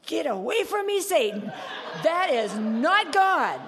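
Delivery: high, loud, laughing cry at Satan; hammed-up "get away from me, Satan" with a laugh; mock rebuke. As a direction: shout it off like chasing away the devil, half laughing. Retold rebuke of supposed Satan.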